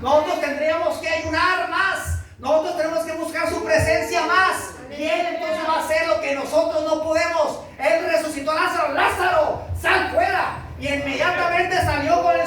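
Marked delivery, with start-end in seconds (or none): 0.0-8.9 raised, ringing sermon voice, higher and pushing; fervent pulpit preaching. Acted. Pastor preaching at full force. 8.9-10.6 higher, ringing command to the tomb; Jesus calling Lazarus from the tomb, commanding. Acted.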